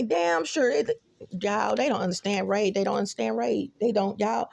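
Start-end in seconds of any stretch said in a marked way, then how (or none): none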